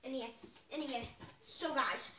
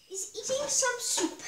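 excited; 9 semitones higher, 8 dB louder than usual. Real, not acted. High loud announcement.

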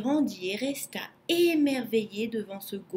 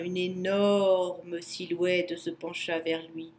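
ordinary delivery

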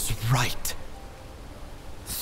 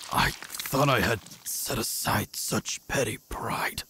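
slow, soft whisper; whispered regret; 2 semitones lower, 8 dB quieter, slower than usual. Acted. Dying assassin reflecting on his pride, slow whisper. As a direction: breathe it out like a last confession.

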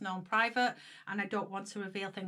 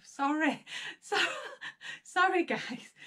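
teary laugh; 7 semitones higher than usual. Real, not acted. Overcome while reading letter.

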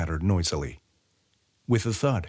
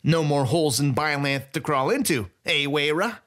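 put-on gloating thief greeting an old rival; gleeful Sai; 6 semitones higher than usual. A narrator's reading. Sai voiced by narrator.

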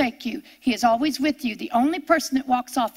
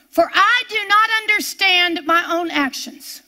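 scripture reading; 3 semitones higher than usual. Acted.

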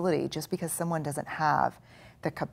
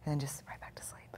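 breathy whisper, much softer; sleepy whisper; 15 dB quieter than usual. Shown as a demonstration. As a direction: whisper as if falling asleep. Drifting back to sleep.

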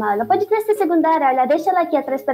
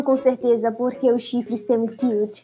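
ordinary delivery

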